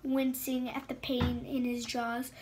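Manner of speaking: ordinary delivery, nothing marked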